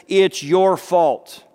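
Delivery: a friend blaming Job for his losses, slow and pointed; blaming accusation. Retold or imitated. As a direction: lean hard on every word of the blame. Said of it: "the answer to that is".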